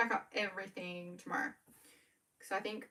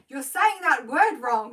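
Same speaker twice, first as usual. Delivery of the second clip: slow, loud, higher-pitched mock correction; mock critical commenter; 4 semitones higher, 10 dB louder, slower than usual. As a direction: imitate a nitpicker, exaggerated and loud. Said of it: "the only mean comments".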